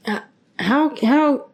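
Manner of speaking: exasperated high how; exasperated teacher's how; frustrated. Retold or imitated. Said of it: "Jesus is frustrated".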